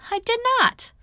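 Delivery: high, loud indignant denial; scoffing. A narrator's reading. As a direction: scoff it off in protest. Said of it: "Zoe scoffed".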